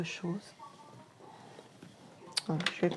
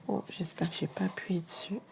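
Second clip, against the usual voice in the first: petit chuchotement inquiet pour soi; chuchoté; 7 dB quieter than usual. Real, not acted. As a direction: murmure soufflé, un peu soucieux. Inquiète d'avoir appuyé. (chuchotement pour soi en inspectant le travail)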